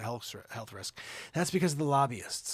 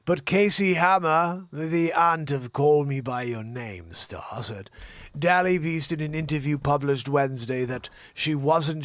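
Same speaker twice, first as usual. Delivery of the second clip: put-on Jeremy Irons English accent; 4 dB louder than usual. Host reads celebrity scandal in put-on English accent. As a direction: read it as a grand English actor. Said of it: "My English accent is essentially Jeremy Irons".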